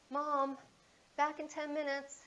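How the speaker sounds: retold yell, called out through the house; yelling across the house. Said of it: "he yelled across the house".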